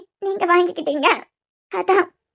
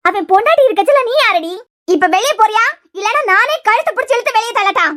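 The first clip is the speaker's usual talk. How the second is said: angry threatening; 3 semitones higher than usual. Acted. Aunt ordering the girl out of the room, angry. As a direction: threaten to throw her out.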